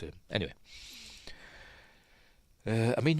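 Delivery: ordinary talk, nothing marked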